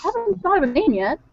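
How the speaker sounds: high, eager offer; so excited. Acted. Bar owner offers Kay the kitten as drinking buddy, excited. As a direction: pitch it up with bubbly eagerness. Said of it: "so excited about this".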